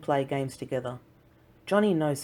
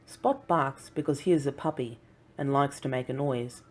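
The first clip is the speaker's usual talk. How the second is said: ordinary delivery, nothing marked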